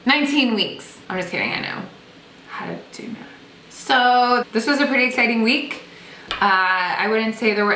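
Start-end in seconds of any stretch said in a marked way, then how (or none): 2.5-3.3 whisper. Real, not acted. Whispered joke aside.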